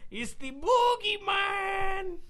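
slow, high, spooky boogeyman call; spooky announcer. Pretend. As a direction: wail the name like a haunted-house barker.

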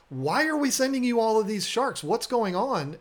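high-pitched, puzzled mock complaint; retold bafflement, pitched well up; imitated baffled caller. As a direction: act out a bewildered phone caller. Retelling puzzled callers from Italy.

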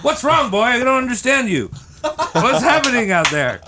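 high, fretful mom voice asking the dog; a concerned lady pleading with her pet, pitched high; worried mom. Acted. Mom asking her dog what's wrong, high worried voice. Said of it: "And she's like".

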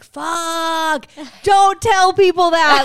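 mock panic. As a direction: blurt it out in alarm. Panicked at being introduced.

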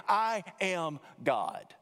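"I am God" slow, each word weighted; slow, solemn, emphatic "I am God"; emphatic declaration. Acted. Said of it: "emphatically and dramatically declaring".

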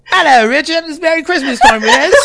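high-pitched mock British accent; hammed-up British urchin, pitched high; Oliver Twist. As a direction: pipe up in a silly British accent. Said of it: "your Oliver Twist voice".

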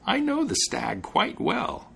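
high, slow, knowing witch. A narrator's reading. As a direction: purr it slyly like a witch. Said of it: "a witch".

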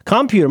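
"computer" said COM-puter; first-syllable stress. Shown as a demonstration. Host echoing the new rule, first-syllable stress on "computer". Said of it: "emphasis on the first syllable".

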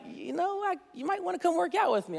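high, soft, tentative suggestion; Pastor Dave. Retold or imitated. Pastor imitating a colleague suggesting a workout, high and soft. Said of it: "Pastor Dave is like".

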